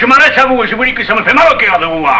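high-pitched, heated retort; angry retort. Acted. Marius confronts older rival Panisse, angry retort.

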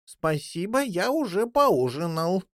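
put-on mildly offended young jackdaw, slightly drawn out. A narrator's reading. Young jackdaw turning down a supper of dead fish, offended. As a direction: refuse with a touch of hurt. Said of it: "обиженно отозвался Голчонок".

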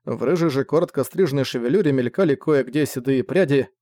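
ordinary delivery, nothing marked

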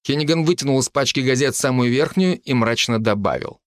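ordinary delivery, nothing marked

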